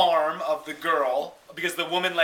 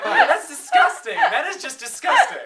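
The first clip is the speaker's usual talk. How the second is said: high, loud laughing revulsion; laughing disgust; 6 dB louder than usual. Retold or imitated. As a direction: exclaim it, revolted and laughing. Own revolted reaction, laughing.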